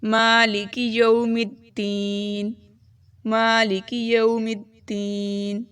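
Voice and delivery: slow drawn مالک یوم الدین; slow recitation. Shown as a demonstration. Teacher reciting full verse. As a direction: recite the whole verse slowly. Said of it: "اس کو ہم پورا پڑھتے ہیں".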